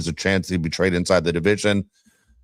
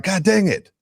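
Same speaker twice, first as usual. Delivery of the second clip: retold frustration; 10 semitones higher, slower than usual. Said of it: "most frustrating and embarrassing thing".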